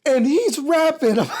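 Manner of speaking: high, laughing disbelief; cracking up. Real, not acted. Host telling friend about rapper's privileged upbringing, cracking up. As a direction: squeeze words out between bursts of laughter.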